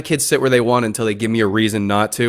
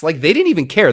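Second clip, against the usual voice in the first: usual speaking voice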